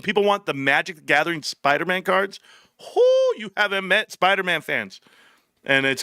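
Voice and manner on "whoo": high, drawn-out whoo; emphatic whoo. Real, not acted. Streamer warning about Spider-Man fans, high whoo. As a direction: let out a long high whoop. Reacting to doubt about fans' demand.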